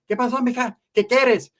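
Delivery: high-pitched acted Spanish holler; man calling out. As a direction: mimic an old man calling to her.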